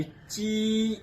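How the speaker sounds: normal talking voice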